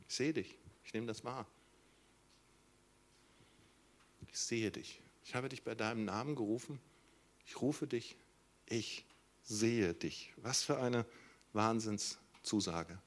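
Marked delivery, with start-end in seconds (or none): 0.1-10.3 tender reassurance. Acted. God's quiet reassuring voice.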